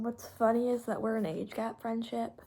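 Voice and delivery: ordinary talk, nothing marked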